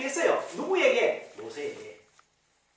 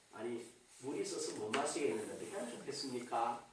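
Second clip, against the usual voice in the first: much softer, lower polite request; asking nicely; 13 semitones lower, 11 dB quieter than usual. Shown as a demonstration. People asking leader Moses about water, softly and nicely. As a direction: model a gentle, deferential question. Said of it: "이쁘게 이야기를 해야지".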